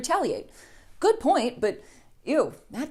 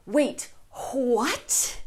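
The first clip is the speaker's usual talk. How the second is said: mock surprise. Everyone reacts again.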